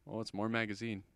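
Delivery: ordinary talk, nothing marked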